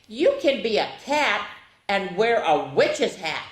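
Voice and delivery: usual speaking voice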